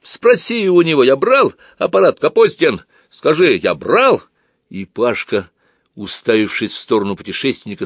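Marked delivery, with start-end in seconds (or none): 0.0-4.2 high, loud indignant shout; закричал. A narrator's reading. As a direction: shout down the accuser, drawing it out. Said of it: "закричал притихший был Бакланов". (возмущённый громкий крик)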